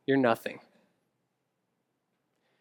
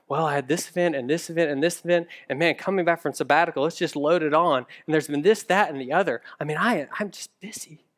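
rattling off; faster than usual. Retold or imitated. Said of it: "I just started rattling off".

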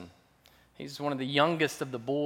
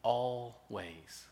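tender promise; 3 dB quieter, much slower than usual. Retold or imitated. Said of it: "She says".

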